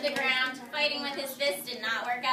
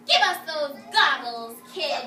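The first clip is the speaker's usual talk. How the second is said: bully demand; 8 dB louder, slower than usual. Acted. Bullies demanding the goggles.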